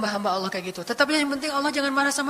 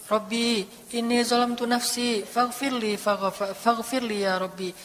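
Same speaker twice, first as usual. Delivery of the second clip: soft recitation; 4 dB quieter, slower than usual. Acted. Recited Quranic prayer.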